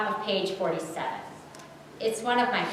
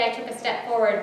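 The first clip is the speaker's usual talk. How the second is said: plain reading voice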